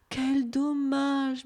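very slow, sighing lament; murmured regret. A narrator's reading. Stunned bunip learns he doesn't exist, murmured regret. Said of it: "murmura-t-il".

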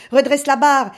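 panicked captain's loud order; shouting. Acted. Panicked captain orders his son.

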